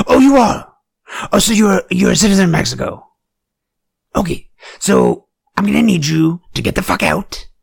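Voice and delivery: high, loud, aggressive grilling; a hammed-up bully demanding papers; mock interrogation. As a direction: corner him with rising hostility. Confronting a man in mock interrogation.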